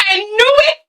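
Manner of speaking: high, squealing grandma who always knew; a grandma bursting with I-told-you-so, pitched high; excited grandma. Retold or imitated. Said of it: "And Grandma's like".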